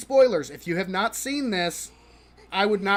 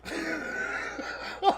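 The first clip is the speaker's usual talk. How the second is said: laughing; 9 semitones higher, 6 dB quieter than usual. Real, not acted. Laugh after the whisper.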